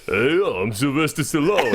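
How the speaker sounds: hammy action-star impression; Stallone. Performing for a stranger's old mother, hammy action-star impression. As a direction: do a bad tough-guy movie star. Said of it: "your part of the impression".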